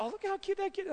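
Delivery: high, soft gushing voice; cooing onlooker. Retold or imitated. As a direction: imitate a stranger fawning over a cute kid.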